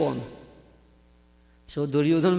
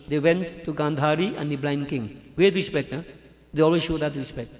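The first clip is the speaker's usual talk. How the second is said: ordinary talk, nothing marked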